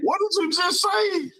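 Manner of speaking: shocked disbelief. Real, not acted. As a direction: cry out stunned. Cohost reacts in shock.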